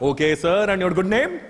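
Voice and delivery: mock Indian call centre accent, polite; a lightly put-on Indian agent asking politely. Call centre agent asks the caller's name in a light Indian accent.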